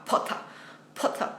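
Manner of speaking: crisp British "Potter" with a dropped R; imitating the typical British sound; British accent. Shown as a demonstration. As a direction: say Potter like a Brit. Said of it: "That's the typical British, British sound".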